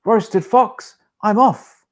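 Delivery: frightened fox fleeing; panicked fox. Acted. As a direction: squeal it and bolt. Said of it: "Fox said".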